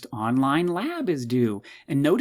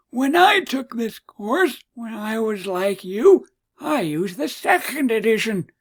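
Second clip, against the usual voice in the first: put-on slow, high old man voice; 9 semitones higher, 5 dB louder, slower than usual. Lecturer teasing students about an old textbook, old man voice. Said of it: "it's my old man voice".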